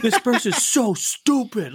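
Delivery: slow, high, laughing mock sneer; a hint of a rude drive-thru worker sneering at a customer; mock snide coworker. Worker mocking a customer on the headset, slow and high through laughter.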